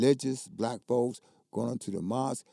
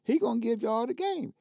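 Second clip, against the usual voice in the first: retold angry protest; 12 semitones higher, 4 dB louder than usual. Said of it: "I said".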